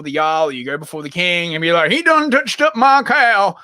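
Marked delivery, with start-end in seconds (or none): 1.9-3.6 high, slow American country complaint; bad US accent. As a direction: whine to the judge in a hammed-up American accent. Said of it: "crap U S accent".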